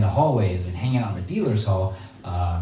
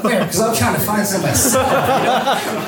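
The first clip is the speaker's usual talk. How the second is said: 1 laughing; 11 semitones higher, 5 dB louder than usual. Retold or imitated. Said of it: "And I'm like".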